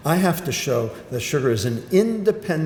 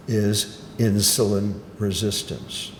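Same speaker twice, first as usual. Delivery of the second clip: usual speaking voice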